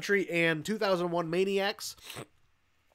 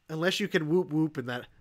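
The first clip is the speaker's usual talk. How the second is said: ordinary delivery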